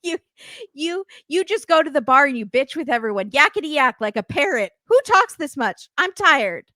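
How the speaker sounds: hammed-up tirade of a worn-out boyfriend; exasperated rant. [name] complaining to Jasmine, exasperated rant. Acted rant in couple's quarrel.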